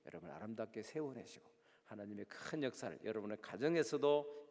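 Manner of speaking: low, soft, half-whispered blessing; hushed prayerful close. Acted. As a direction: bless the congregation quietly and earnestly. (낮고 조용한 축복 기도)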